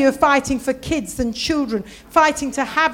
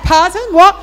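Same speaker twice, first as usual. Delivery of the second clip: loud, high, incredulous questions; startled disbelief; 6 semitones higher, 12 dB louder than usual. Retold or imitated. Guest querying the receptionist's room number, startled. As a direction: blurt it out in mock shock.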